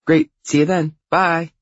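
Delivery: loud, high sign-off; high-pitched, satisfied sister voice; brisk little sister. Acted. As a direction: wrap up the call high and loud. Sister signs off triumphantly.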